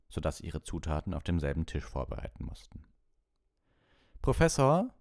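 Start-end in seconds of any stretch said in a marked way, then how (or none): 4.2-4.9 high, loud schoolboy call for the teacher. A narrator's reading. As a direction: call out to the professor, high-pitched. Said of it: "rief Malfoy".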